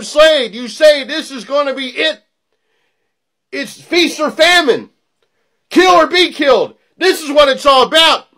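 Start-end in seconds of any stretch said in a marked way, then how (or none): none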